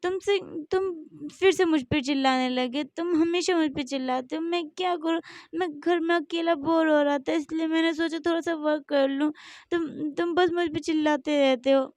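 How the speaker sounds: high, tearful complaint; crying. Acted. Jimin crying at Jungkook for always shouting, tearful. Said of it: "Jimin रोते हुए बोलता है".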